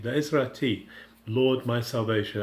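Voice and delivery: normal talking voice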